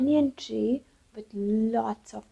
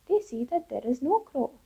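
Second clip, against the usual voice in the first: usual speaking voice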